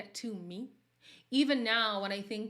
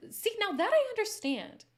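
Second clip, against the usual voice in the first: excited; 13 semitones higher, 10 dB louder than usual. Real, not acted. Loud high approval.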